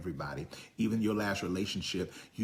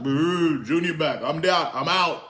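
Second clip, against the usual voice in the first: high, loud mock calf; comic calf voice; 8 semitones higher, 11 dB louder than usual. The calf reacting to its fate, comic high voice. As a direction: play the doomed calf for laughs. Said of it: "The calf was like".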